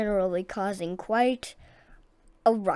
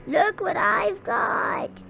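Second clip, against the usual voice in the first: much higher, slow taunting call; teasing call; 11 semitones higher, 5 dB louder, slower than usual. A narrator's reading. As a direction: tease the cat. Captain taunts cat.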